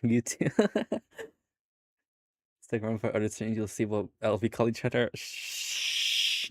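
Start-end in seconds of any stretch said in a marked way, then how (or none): 0.5-1.2 high, giggly laugh; quick high-pitched cackle; real laugh. Real, not acted. Laughs at chat message. 5.1-6.5 long hissed shush; swelling, breath-only shush. Real, not acted. Shushes friend in chat.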